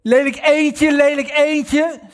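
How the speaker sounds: imitating a mother mocking her daughter. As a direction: taunt the child cruelly. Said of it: "zei ze dan".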